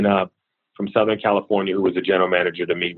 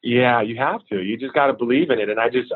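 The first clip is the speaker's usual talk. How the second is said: ordinary talk, nothing marked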